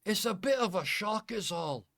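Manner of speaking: high, loud apologetic Scotsman; flustered Scottish innkeeper apologising; Scottish brogue, sorry and shaken; Scottish accent. Acted. GM plays the Scotsman Callum.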